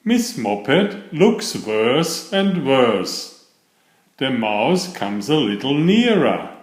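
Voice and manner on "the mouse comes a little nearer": ordinary delivery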